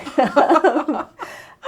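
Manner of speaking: loud, high burst of laughter; rising amused laugh; real laugh. Real, not acted. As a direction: laugh at your own naivety. Laughing at her younger self.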